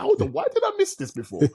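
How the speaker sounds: high, amazed realisation; surprised. Retold or imitated. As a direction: squeak it out in shock. Retelling own shocked realisation.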